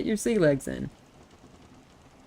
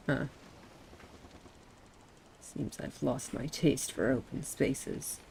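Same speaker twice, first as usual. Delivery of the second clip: ordinary talk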